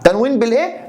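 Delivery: rising prompt on بالايه, waiting for the class; teacher's fill-in-the-blank prompt; prompting question. Shown as a demonstration. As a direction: ask بالايه with a lifted, open end. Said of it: "teacher prompts class".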